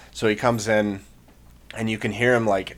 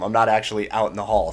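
ordinary talk, nothing marked

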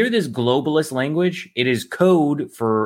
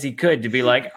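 usual speaking voice

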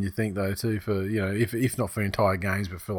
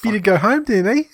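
raised, louder New Zealand impression; mock Kiwi accent; 11 semitones higher, 6 dB louder than usual. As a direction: tease in a high Kiwi accent.

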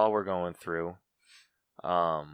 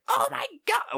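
imitating a hysterical shriek, much higher; mock freakout. Mocking Mary Jane's overreaction, a high hammed-up shriek. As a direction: squeal it like a girl losing her mind. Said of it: "losing her mind".

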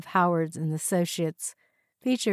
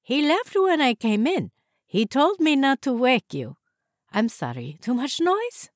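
put-on higher-pitched Hungarian-accented housekeeper; thick Hungarian accent; 7 semitones higher than usual. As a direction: play the housekeeper with a Hungarian accent, questions lifting high. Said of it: "she answered in her thick Hungarian accent".

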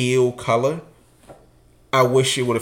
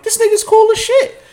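much higher, loud goofy approval; high impressed voice; 4 dB louder than usual. Retold or imitated. Davon thinking the guy is cool, high goofy voice. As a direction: squeak it out like an impressed kid. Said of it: "I'm like".

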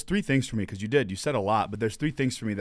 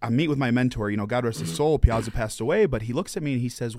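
usual speaking voice

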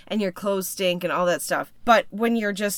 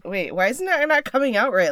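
ordinary delivery

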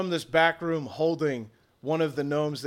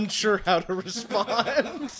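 words breaking into laughter, higher pitched; laughing; 5 semitones higher than usual. Real, not acted. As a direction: stretch it out while cracking up. Game master amused by players' actions.

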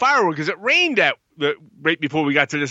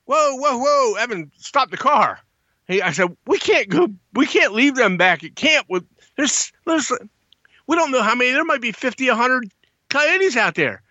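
much higher, urgent pleading; urgent alarm; 7 semitones higher than usual. Retold or imitated. Camper begging driver not to leave friends behind, high and urgent. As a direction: stop him now, panicked. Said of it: "And I'm like".

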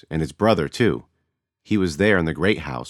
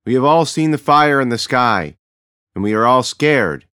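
higher, louder, solemn voice of a leader; a chief calming his frightened people, solemn and loud; chief addressing crowd; 8 semitones higher, 8 dB louder than usual. A narrator's reading. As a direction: speak to the whole great house with authority.

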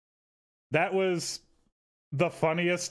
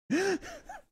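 high-pitched laugh; 4 dB quieter than usual. Real, not acted.

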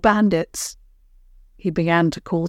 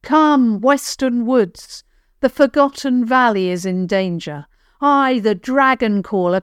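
dragon caller voice; 4 semitones higher than usual. Acted. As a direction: call the forest to rise. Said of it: "used his dragon caller voice".

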